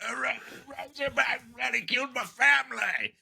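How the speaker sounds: high hammed-up Australian old-timer voice with a laugh; old Aussie man. Mocking the film's old guy telling of the pig, hammed-up Australian accent. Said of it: "that accent there".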